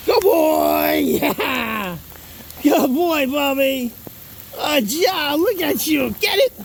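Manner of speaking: high, gushing praise for the dog; shower the dog with high praise; praising the dog. Real, not acted. Praising dog after trick.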